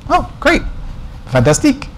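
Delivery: a jokey French "Fantastique", high and bright on "Oh"; mock French accent. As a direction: play the French carrier rep, hamming up the French accent. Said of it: "It's French, you know".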